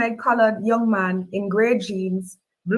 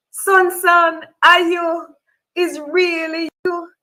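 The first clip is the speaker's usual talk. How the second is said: overwhelmed plea; 9 semitones higher, 4 dB louder, slower than usual. A narrator's reading. Mother reunites with lost son.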